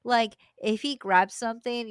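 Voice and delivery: ordinary talk, nothing marked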